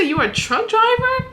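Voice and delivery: high, surprised retold question; incredulous question. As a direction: retell it in rising disbelief. Retells her surprised question to him.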